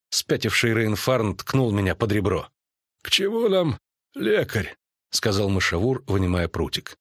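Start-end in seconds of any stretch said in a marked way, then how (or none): 3.0-4.8 high-pitched druid voice. Acted. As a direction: dismiss it in a high character voice. Друид Мышавур, высокий голос. (друид, высоким голосом)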